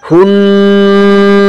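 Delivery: হুন্না with a long, steady hum on ন; held ghunnah. Shown as a demonstration. Teacher demonstrating ghunnah to students, হুন্না with prolonged ন. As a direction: hold the ন of হুন্না very long, then release. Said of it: "কিছুক্ষণ সময় দূরে রাখতে হবে".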